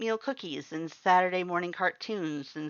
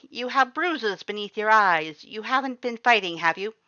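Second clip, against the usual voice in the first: loud, concerned; 6 semitones higher, 7 dB louder than usual. A narrator's reading. Skeleton checking her bruised face, loud with concern. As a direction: scold gently but loudly, worried. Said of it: "his loud voice filled with concern".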